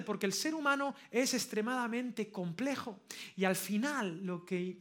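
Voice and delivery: normal talking voice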